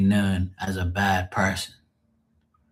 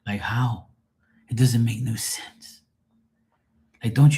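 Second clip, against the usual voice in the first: hushed whisper; 2 semitones higher than usual. Real, not acted.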